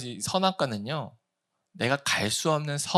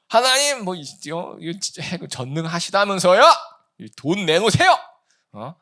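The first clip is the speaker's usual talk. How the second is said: loud, indignant demand at God; demanding complaint; 3 semitones higher, 5 dB louder than usual. Retold or imitated. Preacher mimics believers angrily demanding from God. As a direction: whine and demand like a spoiled child. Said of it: "이러는데".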